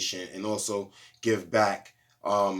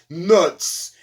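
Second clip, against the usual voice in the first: barked command; 7 semitones higher, 10 dB louder than usual. Retold or imitated. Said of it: "And he was like".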